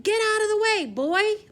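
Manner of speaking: loud, high royal command; loud order. Acted. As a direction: order him away at full voice. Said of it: "ordered the king".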